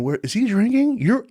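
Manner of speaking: high-pitched anxious questioning; worried wife imitation. Wife phoning the assistant about drinking, high mock worry.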